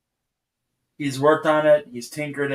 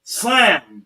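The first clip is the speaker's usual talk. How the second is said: imitated slam; 5 semitones higher, 6 dB louder than usual.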